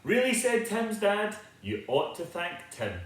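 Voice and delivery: usual speaking voice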